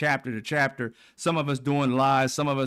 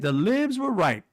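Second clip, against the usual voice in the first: high, slow mocking voice; mock pundit; 7 semitones higher than usual. Vlogger quoting pundit's admission, high and slow. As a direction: drag it out in high mimicry. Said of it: "Then he said".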